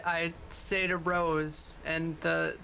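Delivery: ordinary delivery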